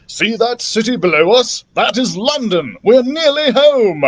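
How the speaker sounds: excited shout. Acted. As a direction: shout it over the wind, thrilled to be home. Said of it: "Doctor Drake shouted".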